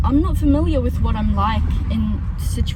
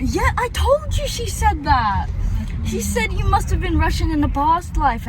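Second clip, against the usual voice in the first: excited insisting; 8 semitones higher, 4 dB louder than usual. Real, not acted. Excitedly confirming the compliment to companion.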